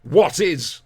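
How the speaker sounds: WHAT IS punched high and loud; forceful stress. Retold or imitated. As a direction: hit "What is" hard, like a quiz contestant. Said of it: "they put such force on the".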